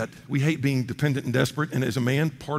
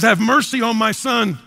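a loud, slow plea that rises high; a desperate father shouting to Jesus for his son; desperate cry; 5 semitones higher, 4 dB louder than usual. Acted.

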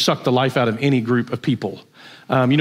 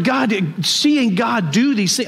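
choked, tearful voice; real crying; 5 semitones higher, slower than usual. Real, not acted. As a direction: speak through real tears. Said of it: "just bawling".

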